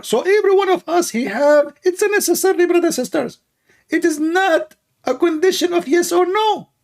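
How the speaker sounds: high, squeaky parody of a preacher addressing his flock; Ali Dawa. Acted. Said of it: "Let us ask Ali Dawa why".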